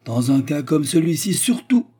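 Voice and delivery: high, loud, climbing to the end; insist, pushing up on SURTOUT; indignant rising emphasis; emphatic insistence. A narrator's reading. Treumann insists, Anna echoes it calmly.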